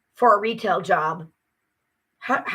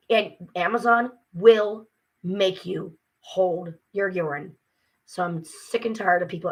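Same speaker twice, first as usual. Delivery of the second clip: ordinary talk, nothing marked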